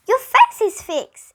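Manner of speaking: high, loud, slow relieved cheer; delighted exclamation. A narrator's reading. Girl seeing Dana's face unstick, delighted cheer. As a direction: burst out with relief and joy. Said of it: "exclaimed Jenny".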